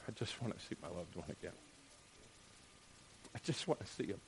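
a grieving relative, very soft; hushed grief. Retold or imitated. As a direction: barely voice the longing. Grieving family member.

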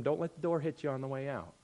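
understated sarcastic retort; quiet sarcastic echo. Retold or imitated. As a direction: hand it back under your breath. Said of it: "I told them".

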